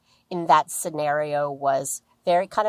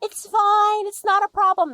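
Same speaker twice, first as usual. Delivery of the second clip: people-pleasing wife. Retold or imitated. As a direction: insist it's fine in a sweet high voice. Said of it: "still being in people-pleasing mode".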